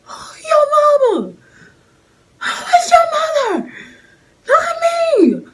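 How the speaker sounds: very high, loud screaming yo mama; panicked shriek. Retold or imitated. Yelling at the little boy who called her mama, very high and loud. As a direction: shriek it in horror.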